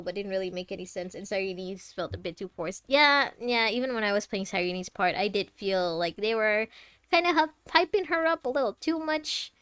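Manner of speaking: usual speaking voice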